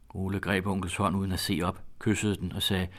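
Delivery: ordinary delivery, nothing marked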